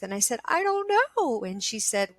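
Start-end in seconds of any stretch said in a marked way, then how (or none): 0.5-1.4 high, helpless wail; crying. Retold or imitated. Answering the therapist in her retold session, crying. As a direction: re-enact a tearful, lost reply. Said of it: "I was crying".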